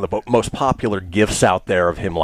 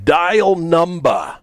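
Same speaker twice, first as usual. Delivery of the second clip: put-on high, very drawn-out New Jersey accent; thick New Jersey accent; 7 semitones higher, much slower than usual. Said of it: "in his thick New Jersey accent".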